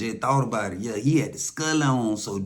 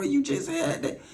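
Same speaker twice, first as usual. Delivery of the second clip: disbelief. Retold or imitated. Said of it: "I'm like".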